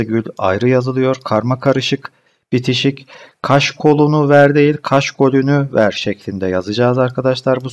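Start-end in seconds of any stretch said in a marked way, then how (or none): none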